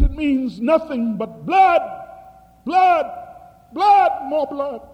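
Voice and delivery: high, loud ominous cry of blood; prophetic cry. Retold or imitated.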